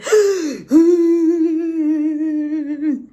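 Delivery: loud, high imitated sob; long hammed-up bawling noise; mock crying wail. Trainee breaking down before sergeant, mock wailing. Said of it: "and I broke down in tears".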